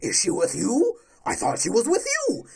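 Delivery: sarcastic Batman line; mocking quote. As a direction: parody how cool it thinks it is. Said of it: "supposed to be something fucking cool".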